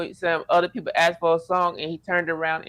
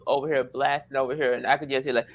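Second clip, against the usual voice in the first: normal talking voice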